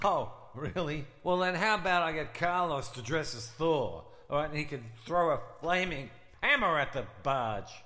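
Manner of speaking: loud, sarcastic retort; angry. Acted. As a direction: shout the sarcastic offer. Heated argument, louder.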